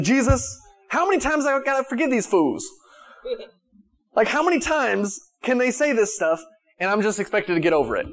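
hammed-up fed-up disciple throwing up his hands; exasperated Peter.

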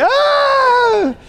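high, long mock scream; hammed-up shriek of alarm; startled scream. Adam startled at God's call.

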